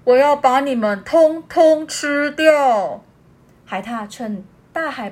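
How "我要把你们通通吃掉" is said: menacing big seal. Acted. Big seal threatening the otter and owl, slow and loud. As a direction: growl it out like a hungry sea beast.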